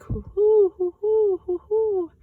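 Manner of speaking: very high, very loud hooting owl call; Pa answers the owl with a high loud hoot; owl call back. Acted. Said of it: "and then he called back".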